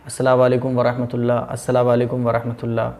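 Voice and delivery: low, hurried "अस्सलाम वालेकुम वरहमतुल्लाह"; common mistaken salam. Retold or imitated. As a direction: run "अस्सलाम वालेकुम" together by habit. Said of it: "तो क्या करते हैं?". (जल्दी में दबी आवाज़ का गलत सलाम)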